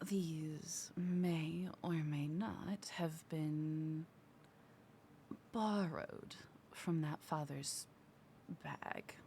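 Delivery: hushed conspiratorial whisper. Acted. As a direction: whisper it like a sneaky rogue. Rogue whispers in character.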